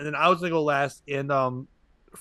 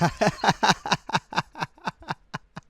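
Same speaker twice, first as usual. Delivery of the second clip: real laugh; 4 semitones higher than usual. Real, not acted. Teller cracking up at his own story, real laugh. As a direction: open laugh.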